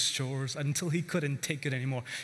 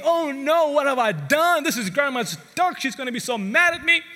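mock panic; 8 semitones higher, 8 dB louder than usual. Boy panics over dead duck.

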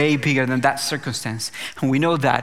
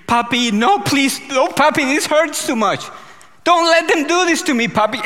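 high, louder pleading child's cry, mimicked; retold child's pleading scream; 9 semitones higher, 4 dB louder than usual. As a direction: echo a frightened little girl begging her dad. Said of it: "while she screamed".

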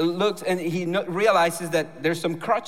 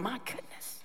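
breathy, tear-choked whisper; moved to tears, whispering; tearful whisper; 4 semitones higher, 15 dB quieter than usual. Real, not acted. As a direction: barely voice it through tears. Everyone crying over her story.

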